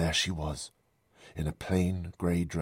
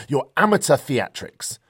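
loud, high, sneering dismissal; loud mockery; 10 semitones higher, 10 dB louder than usual. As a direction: ridicule her openly, voice raised. Professor mocks the medium.

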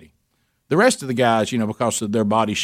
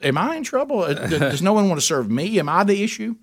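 much higher, mock wounded questions; mock hurt surprise; 6 semitones higher than usual. Guest asks the carver if he is the problem, mock wounded and high. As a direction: play the innocent victim. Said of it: "I said".

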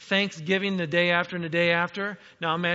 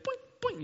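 a high, bouncy "boing" noise; high boing sound; 6 dB quieter than usual. Retold or imitated. A preacher mimicking quail dropping, with a high bouncy boing. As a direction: bounce the sound up like a cartoon spring.

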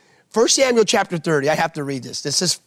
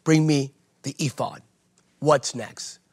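lightly voiced David; 4 semitones lower, 3 dB quieter than usual. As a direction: quietly voice a man who is done grieving. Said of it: "There came a moment where he went".

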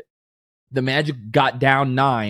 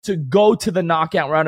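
fired up; 7 semitones higher than usual. Real, not acted. Still fired up about next game.

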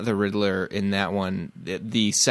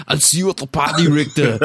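loud, higher-pitched Austrian action-hero growl; Arnold Schwarzenegger; 7 semitones higher, 8 dB louder than usual. Acted. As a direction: belt it out like an action-movie one-liner. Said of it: "stare at myself in the mirror and be like".

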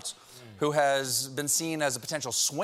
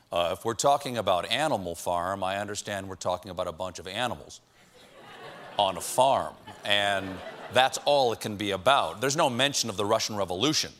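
Clarence Thomas impression; 7 semitones lower than usual. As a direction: lower the voice, deadpan and puzzled. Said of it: "Clarence Thomas in a lit class".

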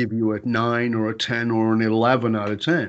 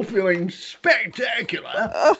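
high, drawn-out, hammed-up self-glory; mock grandiose; 8 semitones higher, much slower than usual. Husband on top of his pedestal, mock grandiose. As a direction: revel in it with exaggerated pride. Mocking his own inflated pride.

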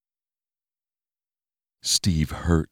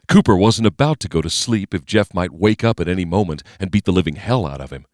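usual speaking voice

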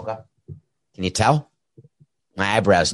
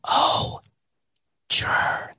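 breathy whispered fragment, no voice; imitating a whisper. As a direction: whisper it like a producer feeding lines. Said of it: "He's whispering".

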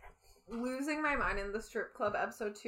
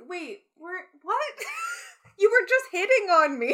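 baffled disbelief; 7 semitones higher, 6 dB louder than usual. Retold or imitated. Woman rejecting a man's advances, stunned. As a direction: go from confused to outraged. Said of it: "Where it's like".

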